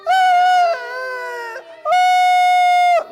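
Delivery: put-on loud, high baby wail; mock baby cry. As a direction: wail like a baby at full volume. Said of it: "But the baby crying again".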